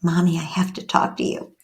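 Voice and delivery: soft whispered signal phrase. Shown as a demonstration. Mother teaching child the signal, soft whisper. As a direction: whisper it like a secret cue.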